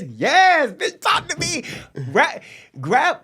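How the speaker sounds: high, heated bedroom cry, played up; mock passionate demand. Acts out bedroom demand to partner.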